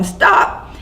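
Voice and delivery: ordinary talk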